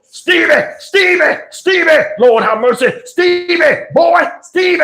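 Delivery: man hacking through weeds, calling; frantic calling. Retold or imitated. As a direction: search for the lost boy by yelling. John Wash searching, calling.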